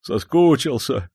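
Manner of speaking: a servant confessing his longing for home; sincere longing. A narrator's reading. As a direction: admit your homesickness from the heart. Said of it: "искренне признался Орёлка".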